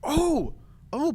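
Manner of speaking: normal talking voice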